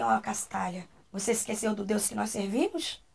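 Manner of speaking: low, softened admonishing; retold grave, hushed reproach; solemn gentle rebuke. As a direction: quietly remind her, voice dropped. Elder's acted solemn rebuke.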